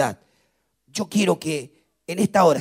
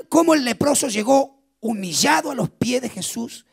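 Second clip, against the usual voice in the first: normal talking voice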